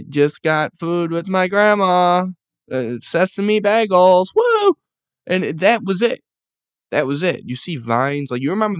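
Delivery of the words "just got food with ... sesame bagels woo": mock-cheerful, slower, louder, higher, ending in a squeaky woo; cheery early post. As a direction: read an innocent happy post with glee. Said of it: "and it's like".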